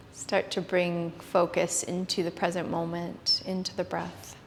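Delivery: hushed yoga class guidance; soft spa whisper. Acted. Leads a guided meditation.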